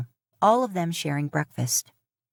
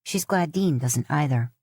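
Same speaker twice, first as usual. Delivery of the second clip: ordinary delivery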